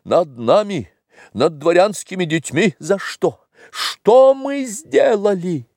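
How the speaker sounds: high-pitched indignant tirade; сердитое восклицание. A narrator's reading. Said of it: "в свою очередь рассердился". (гневная, высокая возмущённая тирада)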